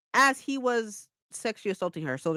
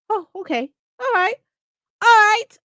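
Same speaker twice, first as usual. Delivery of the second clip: high, getting louder on the final all right; excited acceptance; 11 semitones higher, 5 dB louder than usual. Retold or imitated. Reader forty pages into the book, giddily accepting the twist. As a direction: go along with it, thrilled. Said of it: "I'm just like".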